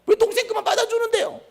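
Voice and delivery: high-pitched, loud sulky complaint with a laugh; whiny aggrieved child. Acted. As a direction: whine like a hurt sibling who feels cheated. Aggrieved sibling complains to parent.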